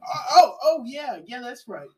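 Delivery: dawning realization that trails off; realizing old man. Retold or imitated. Driver remembers the boys in back, high, fading. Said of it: "And he's like".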